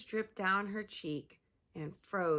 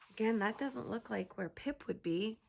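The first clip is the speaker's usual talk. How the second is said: ordinary delivery, nothing marked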